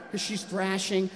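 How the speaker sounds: normal talking voice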